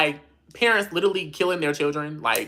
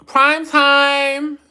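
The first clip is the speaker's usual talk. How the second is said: sing-song shout-out; 9 semitones higher, 6 dB louder than usual. Real, not acted. Greeting a viewer named Prime.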